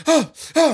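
loud, high gasps of shock; acted startled gasping; startled waking gasps. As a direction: jolt awake gasping. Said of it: "Fontaine wakes up".

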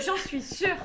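quoting her through a laugh; mock her certainty, laughing; laughing imitation. Friend laughingly mimics host's certainty, laughing. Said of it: "Et ce".

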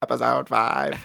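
robotic episode announcement; mock automated voice. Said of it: "a robot voice saying the episode number".

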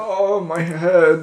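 long, drawn-out moan of pain; groaning complaint. Acted. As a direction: drag it out slowly, as if nursing a sore head. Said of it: "That's like".

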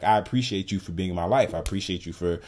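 usual speaking voice

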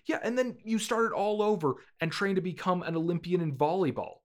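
loud, high, exasperated tirade; berating dad. Acted. Acted father berating his son.

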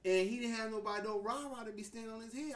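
usual speaking voice

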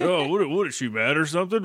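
mock meathead bluster trailing into dopey noises; typical macho guy. Host imitating Jane for the co-host, mock typical-guy bluster. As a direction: imitate an indignant guy, grunting after it. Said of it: "the guy who's mad at the".

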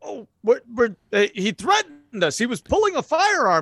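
high, stammering self-defence; flustered officer. Acted. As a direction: blurt out a panicked excuse. Officer defending himself when yelled at.

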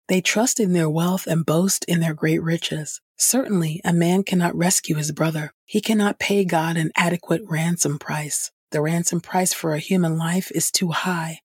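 ordinary talk

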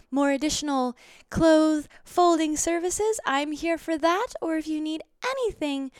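fawning high maid offering every service; sexy hotel maid. Pretend. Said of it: "like a sexy little maid".

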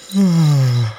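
long lazy yawn; bored yawn. Acted. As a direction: Rain yawns again, unbothered. Rain yawns again.

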